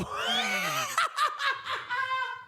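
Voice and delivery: high shrieking burst of laughter. Real, not acted. Streamer shrieks with laughter at forgetting. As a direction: burst out in a shrill cackle. Realizes viewer is German.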